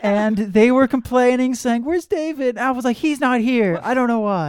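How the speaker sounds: high, laughing housewife voice; 1950s housewife. Pretend. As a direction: play the put-upon housewife, giggling. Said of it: "you sound like an angry housewife".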